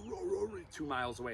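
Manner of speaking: ordinary talk, nothing marked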